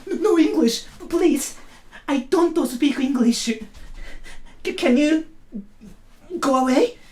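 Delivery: high, flustered no-English voice; frantic high refusal to speak English; panicked Japanese guy. Retold or imitated. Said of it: "this Japanese guy goes like".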